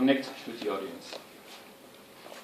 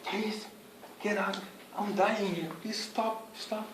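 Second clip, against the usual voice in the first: bored audience begging; 7 semitones higher, 4 dB quieter, slower than usual. Retold or imitated. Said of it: "they will think".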